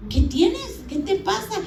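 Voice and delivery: high-pitched mock alarmed questions; retold worried questioning. Husband asking his crying wife what's wrong, high retold alarm. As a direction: imitate a husband asking urgently, pitch jumping up.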